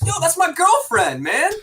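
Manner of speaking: high, loud mock shout of a guy in lockup; hammed-up high yelled quote; quoting a character. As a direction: yell it like the guy in the jail scene. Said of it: "and the guy's like".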